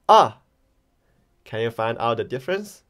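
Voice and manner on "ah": AH dropped sharply from high; short, loud AH falling from a high start. Shown as a demonstration. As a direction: start AH high and drop it fast. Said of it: "we can call it a falling tone".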